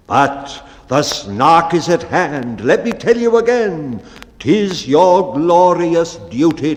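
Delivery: loud, stirring speech; rally the men to duty; rousing bellman. Acted. Bellman rousing his men to hunt the snark, loud and stirring.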